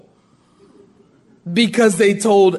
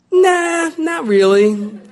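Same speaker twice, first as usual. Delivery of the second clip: preacher mocking the network salesman, laughing; laughing refusal; 7 semitones higher, slower than usual. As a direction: brush him off with a laugh.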